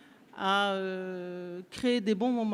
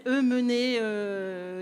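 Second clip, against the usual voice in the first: usual speaking voice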